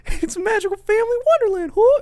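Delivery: very high, fast cartoon mascot; squeaky cartoon voice. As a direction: rattle it off like a cartoon mouse. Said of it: "He's like".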